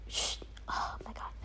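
barely audible whisper; hushed aside. Real, not acted. Vlogger hushing mid-thought, whispered. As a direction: whisper it to yourself under your breath.

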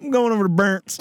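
a hint of a Southern guy; Southern accent. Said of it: "Southern guy saying Bert".

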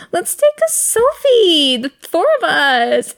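high, slow, chirpy selfie request; mock cheerful. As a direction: imitate an oblivious happy friend. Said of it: "Mohammed was like".

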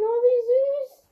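high, loud, slow "so cute" squeal; cooing delight. Real, not acted. Player rides her new boar and coos at it, high and slow. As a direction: gush over the pet boar.

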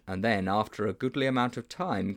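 ordinary delivery, nothing marked

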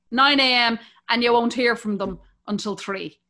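ordinary talk, nothing marked

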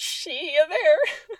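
"see ya there" high, very slow, cracking into a laughing wail; drawn-out "see ya". Shown as a demonstration. Mocking her teen spelling to viewers, slow high wail. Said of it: "but it's".

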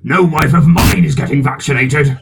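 loud, blustering voice of an outraged husband; Vernon. Acted. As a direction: bellow it like an overbearing husband. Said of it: "Vernon has been like".

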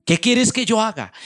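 cried out high and loud, begging; a desperate plea flung upward at full voice; pleading cry. Acted. Saul begging the Lord what to do, a high loud cry.

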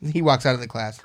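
ordinary delivery

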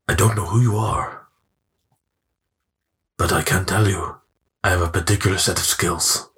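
measured menace with long pauses; Liam Neeson. Acted. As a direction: deliver a deliberate, chilling film warning. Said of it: "Liam Neeson".

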